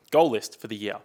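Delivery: ordinary talk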